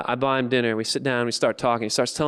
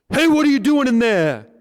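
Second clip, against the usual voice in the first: loud, high challenge; confrontational shout; 12 semitones higher, 7 dB louder than usual. Acted. Two big guys challenge him through car windows, loud confrontational shout. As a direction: yell into the car at the intruder.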